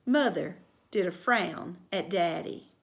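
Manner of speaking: plain reading voice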